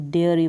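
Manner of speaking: teacher demonstrating डेयरी; dairy pronunciation. Shown as a demonstration. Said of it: "इसको ई के रूप में प्रोनाउंस करेंगे वाई को".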